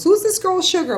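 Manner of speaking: curious sister. Retold or imitated. Sister asking about the stranger defending her, surprised. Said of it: "My sister saw it and goes".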